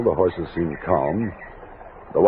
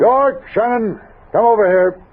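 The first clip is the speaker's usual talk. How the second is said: much higher and louder barked order; commanding shout; 12 semitones higher, 5 dB louder than usual. Acted. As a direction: cut the fight off with one shout. Captain breaks up the fight.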